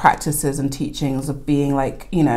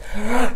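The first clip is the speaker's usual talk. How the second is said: amazed gasp; 6 semitones higher than usual. Retold or imitated. Amazed at Australian coffee.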